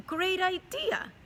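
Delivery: high, slow and delighted; excited. A narrator's reading. Teacher reading a story to class, delighted. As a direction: sell the great idea with glee.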